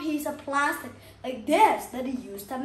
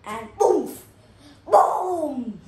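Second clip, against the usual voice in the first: "boom" shouted loud, imitating a bang; two loud booms like something exploding; loud boom sound; 7 dB louder, much slower than usual. Ruler snapping like a bomb.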